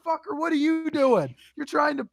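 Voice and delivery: high-pitched mock yell, outraged; retold yelling back. Driver yelling at the passenger trying to hire a hooker, mock outraged shout. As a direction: fire it back at him, indignant.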